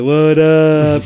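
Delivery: loud, high bro greeting; a laid-back bro saying hi; dude greeting. Retold or imitated. Imitating Robert's greeting.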